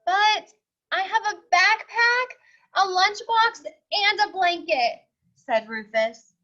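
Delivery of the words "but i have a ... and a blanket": put-on high, loud child pleading; eager protest. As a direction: list it eagerly like a hopeful boy.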